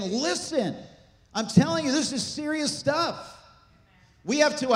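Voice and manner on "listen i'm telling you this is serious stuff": high-pitched, insistent plea to be heard; urgent warning. Acted. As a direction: press the warning home, higher, slowing at the end. Preacher voices Jesus's urgent warning.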